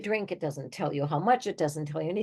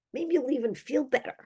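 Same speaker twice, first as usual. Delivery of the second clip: normal talking voice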